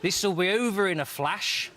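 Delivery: high, slow, bossy Nottingham voice; the Nottingham woman hurrying him, laughing. Acted.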